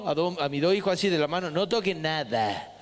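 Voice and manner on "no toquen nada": slow, low, drawn-out warning; father warning kids not to touch cars; stern warning to kids. Retold or imitated. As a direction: hold the kids back firmly. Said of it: "agarrándolo a los dos míos".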